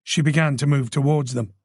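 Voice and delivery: plain reading voice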